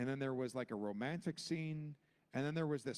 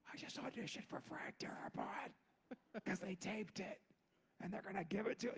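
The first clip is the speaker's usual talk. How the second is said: hushed, breathy disbelief through a laugh; whisper; 4 semitones higher, 7 dB quieter than usual. Retold or imitated. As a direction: whisper it like you can't believe it. Stunned aside to himself.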